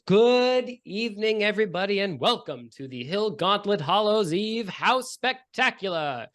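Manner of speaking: high, loud, slow announcer boom; magically projected witch. Acted. The neighborhood witch greets the crowd, slow, high and booming. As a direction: project it across a whole lawn. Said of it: "her voice is magically projected across the whole lawn".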